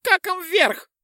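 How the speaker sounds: very high pixie squeak; squeaky pixie. Acted. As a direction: squeak it wryly. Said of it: "пикнула Чопля". (писк пикси)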